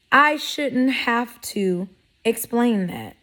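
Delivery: usual speaking voice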